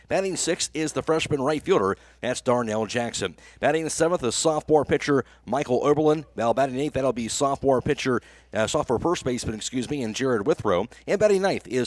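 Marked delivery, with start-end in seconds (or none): none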